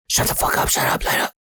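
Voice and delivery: harsh urgent whisper; whispered order to shut up; big brother's hushing. Retold or imitated. Big brother silences the panicking kid, fierce whisper. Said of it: "he's just being big brother right here".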